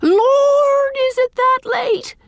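very high, loud surprise; startled exclamation. A narrator's reading. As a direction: cry out in shock at the hour. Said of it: "exclaimed Miss Cornelia".

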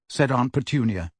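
usual speaking voice

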